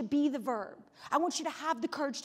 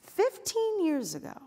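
drawn-out higher start that falls; slow, weighty callback; 5 semitones higher, 3 dB louder than usual. Shown as a demonstration. Speaker echoes her opening line to the audience, slow. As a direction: let each word land slowly.

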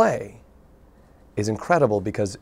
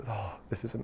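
breathy whispered gasp of relief; a whispered start to the dad's line; 12 dB quieter than usual. Retold or imitated. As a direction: whisper it, overcome. Dad's retold reply.

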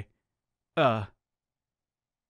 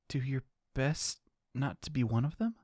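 ordinary delivery

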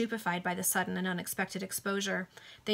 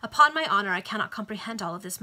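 plain reading voice

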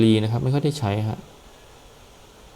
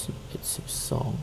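whispering the numbers under his breath; whispered counting; 10 dB quieter, faster than usual. Real, not acted. Gamer whispering numbers while checking shop prices. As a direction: count softly to yourself, barely voiced.

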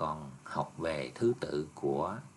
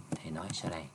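whisper; 5 dB quieter, faster than usual. Real, not acted. Teacher's aside to himself.

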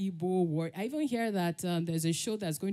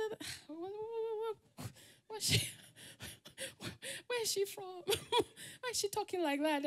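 gossiping schoolkids; 12 semitones higher, 6 dB quieter than usual. Retold or imitated. Imitating schoolkids whispering about her.

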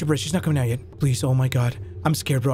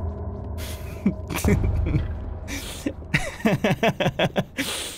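long wheezing laugh; helpless airy laughter; 5 dB quieter than usual. Real, not acted. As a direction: crack up at your own joke, mostly breath. Laughing at own rhyme.

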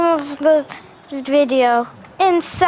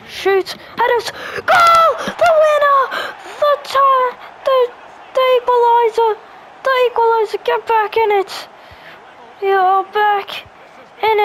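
excited shouting; 6 semitones higher than usual. Real, not acted. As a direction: scream the equaliser with joy. Scoring equaliser while gaming.

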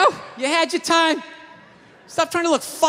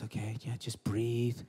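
much lower, much softer calming murmur; soothing therapist; 14 semitones lower, 10 dB quieter, slower than usual. Retold or imitated. Therapist calming him in session, low and soft. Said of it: "he goes".

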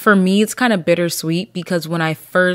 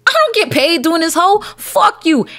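high, louder mock shout at commenters; escalating yell; 10 semitones higher, 3 dB louder than usual. Shown as a demonstration. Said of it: "repeated louder, higher".

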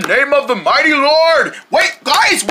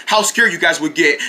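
ordinary delivery

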